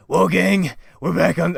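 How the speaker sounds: loud, high cartoon gang-leader call; Fred. Fred rallying the gang on the road, loud put-on voice. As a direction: belt it out like an eager cartoon leader. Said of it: "my best Fred impression".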